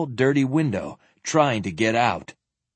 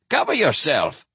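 raised-pitch, scandalized order; shocked; 5 semitones higher than usual. Acted. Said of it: "he said in a shocked voice".

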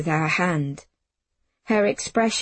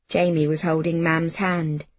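ordinary delivery, nothing marked

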